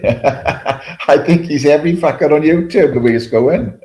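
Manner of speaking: amused, half-laughing remark; laughing while talking. Real, not acted. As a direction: giggle through the joke. Laughing at viewer's many accounts.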